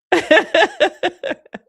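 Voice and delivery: high-pitched rolling cackle; loud high laugh. Real, not acted. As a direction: let out a big high laugh. Laughing at own remark.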